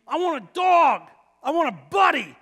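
high, loud declaration of longing; retold plea at full voice; emphatic demand. Acting out his own craving.

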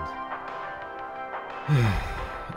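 long, soft exhaled sigh; weary sigh. Acted. Narrator recalling that two thousand twenty happened, weary sigh. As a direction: let out a heavy breath.

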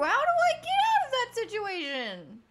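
real panic; panicked wail. Real, not acted. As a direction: wail it while fleeing. Panicked escape in horror game.

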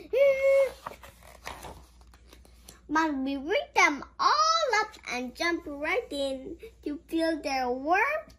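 usual speaking voice

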